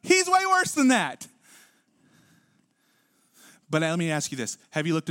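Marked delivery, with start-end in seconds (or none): 0.0-1.3 sudden high outburst of disagreement; emphatic contradiction. Real, not acted. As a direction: burst out and stretch every word. Bursts out against expected soft answer.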